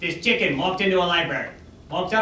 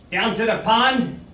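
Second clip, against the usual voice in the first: ordinary talk, nothing marked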